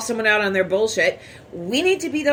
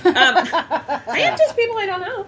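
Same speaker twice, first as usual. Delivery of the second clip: laughing it off, pitched up; giggling through the correction; 8 semitones higher than usual. Real, not acted. Laughing defensive retort.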